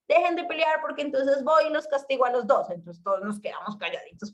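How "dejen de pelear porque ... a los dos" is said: higher-pitched imitation of mom scolding. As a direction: imitate a mother threatening both kids with punishment. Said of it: "porque decía mi mamá".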